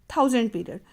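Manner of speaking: ordinary talk, nothing marked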